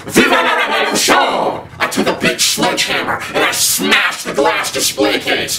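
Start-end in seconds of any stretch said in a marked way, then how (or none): 0.1-1.7 high, hammed-up rallying cry; mock battle cry. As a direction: cry it out like a rebel leader. Rebel cry at Subway.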